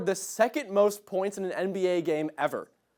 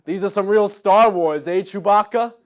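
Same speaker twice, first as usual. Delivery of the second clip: lightly acted movie line, louder; Bob Odenkirk; 2 semitones higher, 7 dB louder than usual. Bob to Chewbacca on the Millennium Falcon, lightly hammed-up. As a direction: play a hint of a hammy name-drop. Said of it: "have him say".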